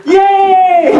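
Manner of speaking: a very high, laughing cheer; delighted victory whoop; gleeful yay. Acted. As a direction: squeal it like a kid. Celebrating his working body.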